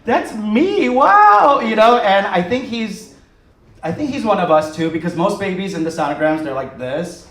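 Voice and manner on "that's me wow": high, loud, delighted gasp of recognition; mock-stunned excitement; amazed squeal. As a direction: squeal it like seeing your twin. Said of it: "I'm like".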